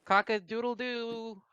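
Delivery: high, drawn-out mock crowing; rooster crow. Player waking everyone up, playful rooster crow. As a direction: crow slowly like a half-hearted rooster.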